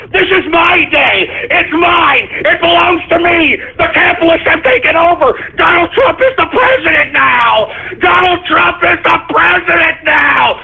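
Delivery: high, shouting, voice near cracking; furious rant. Real, not acted. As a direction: rage at the trolls, nearly crying.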